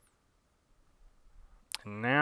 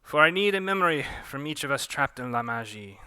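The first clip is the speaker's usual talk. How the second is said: put-on Pontu accent; 4 semitones higher, 7 dB louder than usual. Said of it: "Pontu accent".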